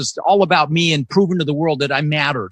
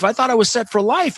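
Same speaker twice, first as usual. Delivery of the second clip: ordinary delivery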